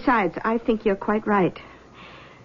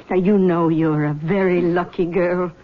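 ordinary talk

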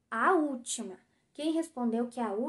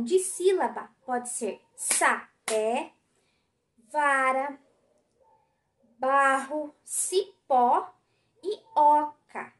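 slow, louder, each word spaced out for the class; slow syllable-by-syllable; 5 dB louder, much slower than usual. Shown as a demonstration. Teacher dictating words slowly to the class. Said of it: "São palavras dissílabas".

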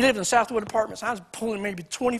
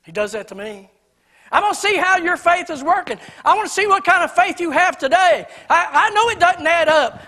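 loud, high, fervent sermon voice; preaching at full force; 6 semitones higher, 7 dB louder than usual. Acted. Preacher booming God's promise to the congregation, full force. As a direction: preach it out to the pews with fire.

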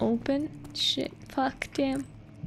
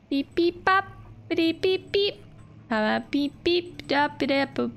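short vocal beeps and boops; imitated piano notes; 2 semitones higher than usual. Gamer pretending to play piano for viewers, imitated notes. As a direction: mimic plinking notes with the mouth. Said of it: "Just imagine I'm playing".

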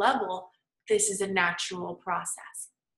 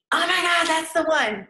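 excited; 7 semitones higher, 8 dB louder than usual. Retold or imitated. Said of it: "I was like".

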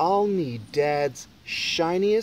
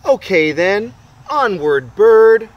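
rallying cry; 5 semitones higher, 11 dB louder than usual. Acted. Boy rallies bird onward.

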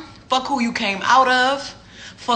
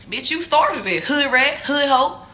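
ordinary talk

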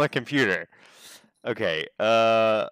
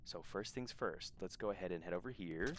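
ordinary talk

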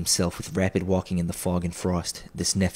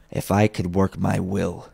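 plain reading voice

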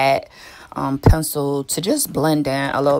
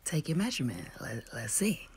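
very soft, confidential murmur; hushed aside, as if keeping it secret; whispering; 12 dB quieter, faster than usual. Real, not acted. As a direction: drop to a near whisper. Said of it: "I don't know who I'm whispering for".

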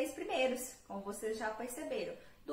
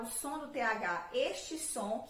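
ordinary talk

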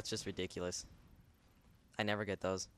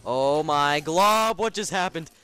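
high, slow, loud amazement; overjoyed shriek; 7 semitones higher, 11 dB louder, slower than usual. Real, not acted. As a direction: shriek with delight. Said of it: "scream like a pterodactyl when I'm happy".

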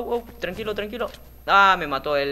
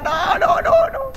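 rising frantic no no no; panicked cries; 8 dB louder, much slower than usual. Real, not acted. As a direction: cry out as it slips away. Falling in game, panic.

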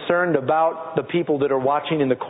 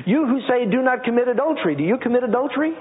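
raised, ringing scripture read; 8 semitones higher than usual. Acted. Preacher reading Romans to the church, raised and ringing. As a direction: proclaim it to the congregation.

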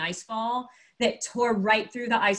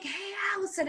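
high-pitched mock call, held back in volume; retold yell; 10 semitones higher, 6 dB quieter than usual. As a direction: imitate a distant hail without real loudness. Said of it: "he starts yelling".

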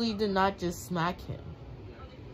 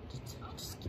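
whisper; 14 dB quieter than usual. Real, not acted. Whispered then loud repeat.

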